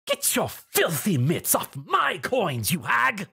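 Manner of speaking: a slow, growled mock bark. A narrator's reading. Shopkeeper barks at a mare stealing coins, mildly angry. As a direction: snarl it at the thief. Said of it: "one of the shopkeepers barked".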